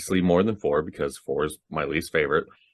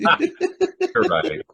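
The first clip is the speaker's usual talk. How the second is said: talking through real laughter; laughing. Real, not acted. Laughing reply.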